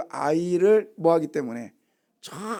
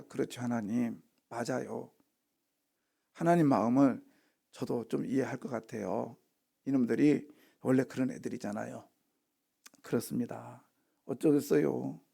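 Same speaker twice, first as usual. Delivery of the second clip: soft, lower, resigned reply; meek agreement, acted; 4 semitones lower, 8 dB quieter than usual. Preacher plays Moses answering God, meek and soft. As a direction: agree quietly, as a humble servant would.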